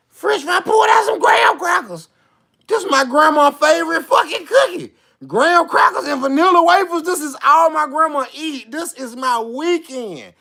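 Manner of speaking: high, excited shout; thrilled by snacks. Retold or imitated. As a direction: exclaim in delighted disbelief.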